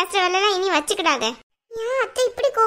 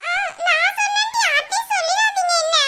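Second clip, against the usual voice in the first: put-on high childlike voice begging grandma; little girl pleading; 10 semitones higher than usual. Girl asks grandma not to tell anyone, high pleading child voice. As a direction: beg like a small girl keeping a secret.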